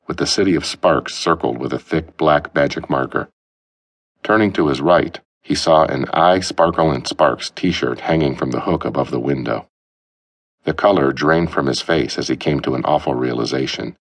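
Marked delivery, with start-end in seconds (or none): none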